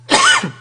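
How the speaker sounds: sharp coughing fit; real cough from the wrong pipe; choking cough. Real, not acted. Choking on drink, went down wrong pipe.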